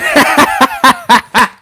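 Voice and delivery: loud, high burst of laughter; real staccato belly laugh; helpless laughter. Real, not acted. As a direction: crack up mid-sentence. Cracks up after own impression.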